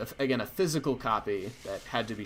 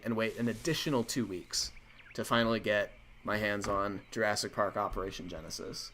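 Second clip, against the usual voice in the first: usual speaking voice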